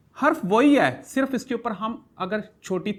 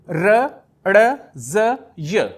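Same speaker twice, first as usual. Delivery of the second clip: र, ड़ stretched very slowly, one letter at a time; slow recitation; 6 dB louder, much slower than usual. Shown as a demonstration. Teacher re-reading the letters for pupils, very slow. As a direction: read the letters out slowly for the class to follow. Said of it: "उनको दोबारा से आपके लिए पढ़ने".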